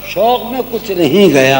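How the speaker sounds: slow, lingering ghazal recital; tender romantic poetry. Acted. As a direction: savour each line of the couplet. Recites his ghazal at the mushaira.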